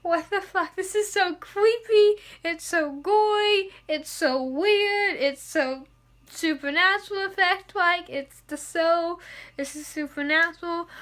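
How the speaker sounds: freaked-out excitement. Retold or imitated. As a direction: gush like you can't believe what's on screen. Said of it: "and I was like".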